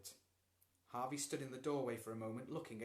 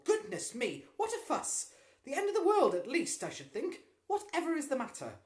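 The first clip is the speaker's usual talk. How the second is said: much higher, louder flustered exclamation; exclaiming; 13 dB louder than usual. A narrator's reading. Mother startled by her son's uproar, loud high exclaiming. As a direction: throw up your hands at the fuss.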